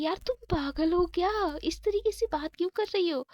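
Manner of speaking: heated, indignant shout; angry outburst. A narrator's reading. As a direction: snap back at her friend in anger. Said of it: "तो प्रीति गुस्सा हो जाती है".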